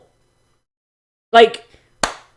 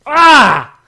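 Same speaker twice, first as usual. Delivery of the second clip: frustrated yell; 6 semitones higher, 8 dB louder than usual. Real, not acted. Frustrated outburst during rant.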